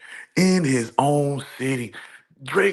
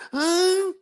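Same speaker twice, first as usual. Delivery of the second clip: high squeaky huh. Real, not acted. Disbelief continues.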